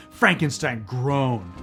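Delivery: ordinary delivery, nothing marked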